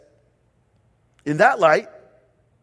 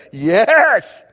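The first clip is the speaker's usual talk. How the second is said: louder, drawn-out mock cheer; exultant cry; 3 semitones higher, 7 dB louder than usual. Preacher acting someone back at church on the weekend, exultant. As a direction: belt it out like a mock worshipper.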